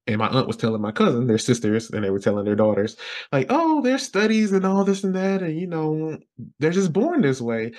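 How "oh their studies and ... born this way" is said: high mock churchy voice; sing-song imitation of the family; mocking relatives. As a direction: parrot the relatives in a high put-on voice.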